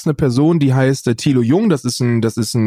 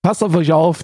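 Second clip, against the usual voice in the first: normal talking voice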